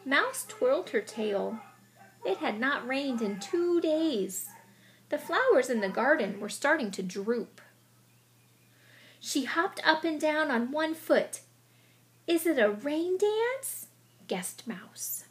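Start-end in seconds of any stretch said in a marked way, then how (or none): 0.1-11.4 story narration. A narrator's reading. Expressive read-aloud narration. 12.3-13.9 high, slow, bright mouse guess; excited question. Acted.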